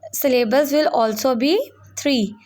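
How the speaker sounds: normal talking voice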